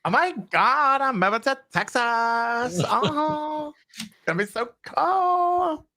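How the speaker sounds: high-pitched, drawn-out gushing caricature; mock starry-eyed excitement, high and slow; Gary. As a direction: pitch it way up and stretch the last words. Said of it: "that's how he actually speaks".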